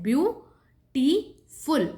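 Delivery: "Beautiful" pronounced as three separate beats, view, टी, फुल; syllable by syllable. Shown as a demonstration. Teacher showing how we say it, "beautiful" broken into three syllables. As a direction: pause between the syllables of "beautiful". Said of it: "हम ऐसे बोलते हैं".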